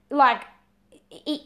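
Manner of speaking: ordinary talk, nothing marked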